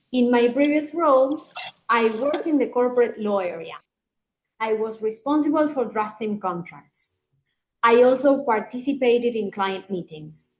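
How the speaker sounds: cada frase termina bajando, "meetings" hacia abajo; falling intonation. Shown as a demonstration. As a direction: describe el rol con tono firme y cerrado. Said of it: "Siempre para abajo". (entonación descendente en entrevista)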